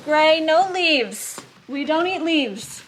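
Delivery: real pet-scolding voice; scolding the dog. Real, not acted. Scolding dog for eating leaves.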